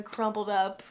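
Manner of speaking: usual speaking voice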